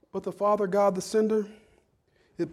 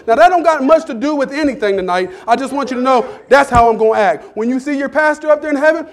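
louder and higher, fast and playful; hyped comic riff; 7 semitones higher, 11 dB louder than usual. Acted. Pastor joking about heaven hair, loud and playful. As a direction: clown it up with rising energy.

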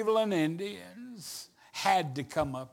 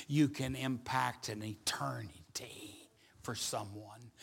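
low, soft, weighty preaching; quiet, low-pitched solemn exhortation; earnest appeal; 9 semitones lower, 11 dB quieter than usual. Real, not acted. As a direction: bring it down to a hushed, sincere charge. Turns from joke to earnest appeal.

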